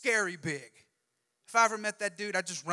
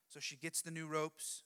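ordinary talk

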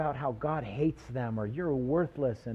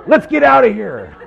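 loud, high laughing line; laughing retell; 9 semitones higher, 16 dB louder than usual. Retold or imitated. Preacher laughing while retelling the scare. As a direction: burst out laughing as you flee.

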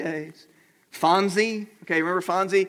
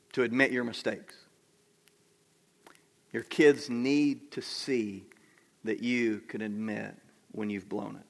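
soft, low, with long pauses; gentle reassurance; 5 semitones lower, 6 dB quieter than usual. Real, not acted. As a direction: quietly give parents permission to be wrong. Gently reassures parents in congregation.

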